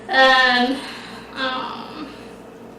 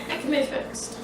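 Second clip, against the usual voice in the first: soft whispered reassurance; 11 dB quieter than usual. Real, not acted. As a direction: breathe it quietly to calm. Reassuring after breaking prop.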